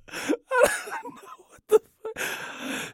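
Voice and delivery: high, weak words lost in laughter; real laughter swallowing the words; breaking up laughing. Real, not acted. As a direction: wheeze it out between laughs. Cannot stop laughing.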